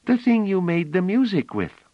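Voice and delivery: put-on little girl pleading; eager child. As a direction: beg like a small excited girl.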